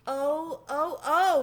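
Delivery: mild moaning lament. Acted. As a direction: groan oh oh in dismay. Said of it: "moaned Tucker Mouse".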